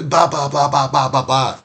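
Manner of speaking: a hint of yelling; mock angry ranting. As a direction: imitate someone going off in nonsense syllables. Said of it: "we're yelling and we're angry".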